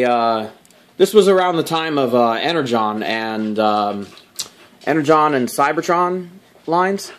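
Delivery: usual speaking voice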